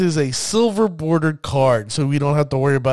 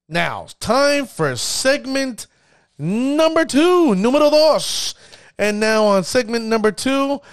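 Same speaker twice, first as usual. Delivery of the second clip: segment announcer; 7 semitones higher, slower than usual. Acted. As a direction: stretch it out like a game show host.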